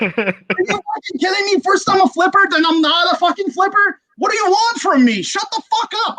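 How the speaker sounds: high-pitched outraged retort; comically irate, voice pushed way up; exasperated rant. Retold or imitated. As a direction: fire back in worked-up disbelief. Retorting furiously to the commenter.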